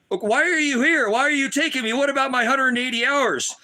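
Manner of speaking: high-pitched imitation of a distressed son; urgent, pleading protest, imitated; retold son's protest. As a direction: act out his alarmed questions.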